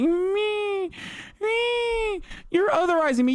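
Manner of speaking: very high mocking baby whine; whiny baby voice. Mocking conservatives as crybabies, with a very high whine. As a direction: squeal it like a toddler throwing a fit. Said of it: "These motherfuckers are such babies".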